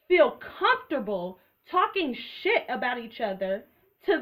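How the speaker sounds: slow emphatic rant. Real, not acted. Ranting rhetorical question at viewers.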